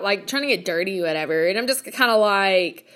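normal talking voice

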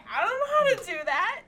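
high, loud, fast worried whine; whiny dragonborn voice. Retold or imitated. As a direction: blurt it out in a panic. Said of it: "And he was like".

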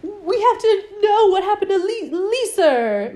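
high, loud, laughing British accent impression; mock British accent, giggling through it. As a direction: send up his slipping British accent. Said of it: "his accent keeps sleep-, slipping".

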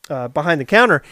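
ordinary delivery

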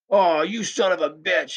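high, loud, drawn-out cursing; outraged yell. A narrator's reading. Drunk cursing his companion after getting soaked, yelling. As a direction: yell it slowly at the rival. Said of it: "yelled the fast one".

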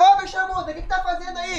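high, put-on yell of the mute girl; mock yell as the mute girl. Retelling a video prank to podcast friends, high mock yell. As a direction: call out in a high put-on voice, imitating her. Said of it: "daí eu gritava".

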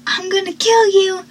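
high-pitched mock death threat. Acts out boyfriend's threat.

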